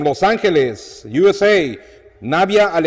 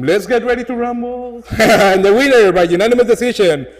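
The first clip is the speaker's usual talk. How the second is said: hyped ring announcer call; louder, rumble-style big announcement; lucha libre announcer; 3 semitones higher, 3 dB louder than usual. Pretend. Ring announcer catchphrase and result.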